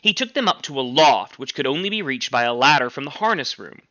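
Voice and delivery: usual speaking voice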